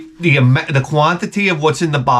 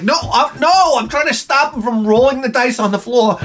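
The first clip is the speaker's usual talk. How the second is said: pitched way up, defensive and pleading; high-pitched protest; 8 semitones higher than usual. Retold or imitated. Husband arguing with his wife over the dice, high defensive protest. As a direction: protest your innocence in a high, strained voice.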